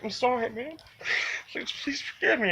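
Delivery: high-pitched, choked-up pleading; tearful apology. Retold or imitated. Apologizing to Michael after slapping him, tearfully pleading. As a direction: beg forgiveness through tears. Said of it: "I just started crying".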